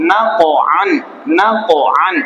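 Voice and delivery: "নাক" released with a bounce, separate from "আন"; touch and spring off the stop in "নাক", then "আন"; qalqalah demo. Shown as a demonstration. Teacher repeating the pronunciation for pupils, measured recitation. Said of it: "উচ্চারণ কি হলো".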